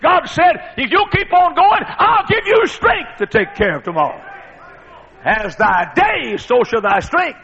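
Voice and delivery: high, loud preaching cry; preaching at full force. Acted. Preacher proclaiming God's promise, high and loud.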